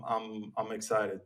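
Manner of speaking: ordinary talk, nothing marked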